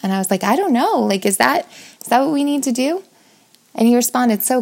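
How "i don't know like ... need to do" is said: higher, hesitant retold question; anxious unsure. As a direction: ask it nervously, voice climbing. Her unsure retold reply.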